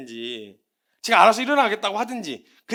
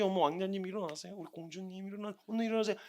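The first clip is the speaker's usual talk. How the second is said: much softer coaxing; mock sweet wake-up; 11 dB quieter than usual. Parent gently cooing a late child awake, softly. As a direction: coo it softly, overly gentle. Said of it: "언제까지".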